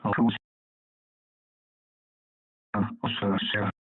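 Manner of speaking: broken radio signal. Real, not acted. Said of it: "The only part I heard of it is Oscar".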